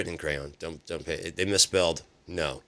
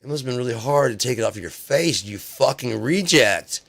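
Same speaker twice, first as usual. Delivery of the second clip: angry rant; 5 semitones higher, 5 dB louder than usual. Real, not acted. Ranting at the president over his mask, loud and scornful. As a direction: spit out the insult with contempt.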